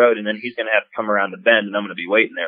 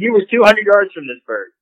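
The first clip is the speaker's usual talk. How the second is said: higher, incredulous comeback; telling the guy he's out of his mind; mock exasperated comeback; exasperated retort; 10 semitones higher than usual. Retold argument with rival hunter.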